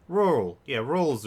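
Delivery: excited agreement. Real, not acted. Streamer excitedly grabs a chat suggestion, loud and high. Chat offers the right word.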